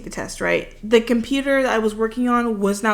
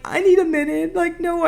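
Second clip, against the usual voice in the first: near tears, retold; 8 semitones higher than usual. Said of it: "on the brink of tears".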